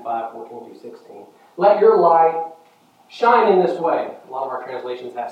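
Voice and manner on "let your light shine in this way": high, loud declaimed verse; scripture proclamation. Acted. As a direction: ring it out from the pulpit in two phrases.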